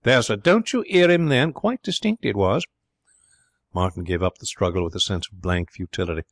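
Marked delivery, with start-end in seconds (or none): 0.0-2.7 high, loud, innocent butler's insistence; insistent butler. Acted. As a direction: press the point, all mock-innocence. Butler Keggs insisting he heard Keith.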